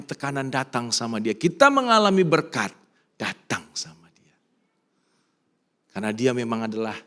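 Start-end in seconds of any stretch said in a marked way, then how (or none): 3.2-4.4 slow, softer whispered repeat; hushed and slow. Shown as a demonstration. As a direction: let it fall to a whisper. Repeated line, whispered second time.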